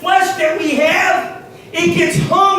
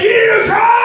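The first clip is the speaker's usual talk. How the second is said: loud, higher demanding cry; voice of the flesh; 5 semitones higher, 4 dB louder, much faster than usual. Pretend. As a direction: let the craving shout its demand. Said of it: "my flesh said".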